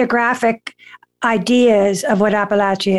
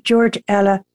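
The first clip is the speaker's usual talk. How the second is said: usual speaking voice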